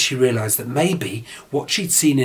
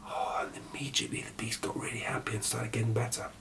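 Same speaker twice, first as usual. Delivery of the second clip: softer, hushed narration; whisper; 11 dB quieter than usual. A narrator's reading. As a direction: whisper the happy twist. Storyteller whispers happy turn.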